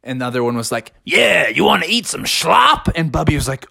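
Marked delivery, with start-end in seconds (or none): 1.0-2.9 pig voice. Acted.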